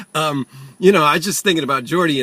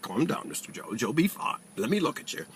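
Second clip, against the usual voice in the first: lower, softer soothing doctor voice; calm doctor; 4 semitones lower, 11 dB quieter than usual. Retold or imitated. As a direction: settle a panicking patient gently. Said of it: "And the doctor's like".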